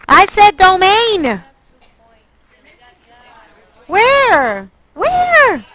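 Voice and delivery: loud, high exasperated scolding, words drawn out; real yelling. Real, not acted. Teacher scolds students for misreading a graph, yelling. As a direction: yell in disbelief at the class. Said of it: "I did not mean to yell at you".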